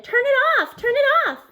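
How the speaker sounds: high, loud, repeated command; urgent yell. Acted. Miss Fountain yelling at Parker to kill the engine, loud and high. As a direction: shout the order twice, urgently. Teacher yells about exhaust smoke.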